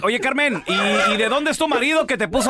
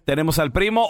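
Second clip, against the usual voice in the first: ordinary delivery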